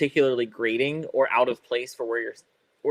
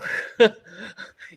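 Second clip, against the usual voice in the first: laugh; 9 semitones higher, 5 dB louder than usual. Real, not acted. Laughing at own remark.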